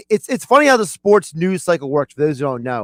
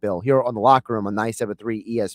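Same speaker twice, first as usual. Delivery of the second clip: quick, low promo patter; radio plug; 8 semitones lower, 4 dB quieter, faster than usual. Real, not acted. Host telling Bill what's coming up at eleven, brisk low plug. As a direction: rattle off the station plug, fast and low.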